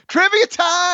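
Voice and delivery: trivia time called out high and loud like a game show host; bright game show jingle voice; excited announcement. Acted.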